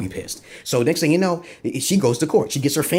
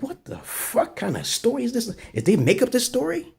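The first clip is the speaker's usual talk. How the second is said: high, disbelieving squeal at the story; mock shock, voice jumping way up; incredulous outburst; 12 semitones higher than usual. To himself about the Snapped story, shrill mock disbelief. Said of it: "I said".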